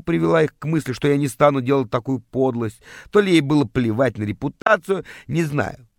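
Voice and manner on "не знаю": ordinary delivery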